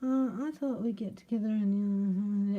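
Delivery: hesitant mumbler, hammed up; mock mumbling. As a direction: mumble it vaguely, dragging the words. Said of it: "uh, you mumbling? I can't stand mumbling".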